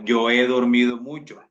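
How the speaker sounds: normal talking voice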